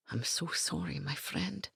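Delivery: very soft, barely voiced apology; whisper. A narrator's reading. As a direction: whisper it over his friend's bed. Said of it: "Gavin whispered".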